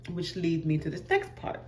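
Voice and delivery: ordinary delivery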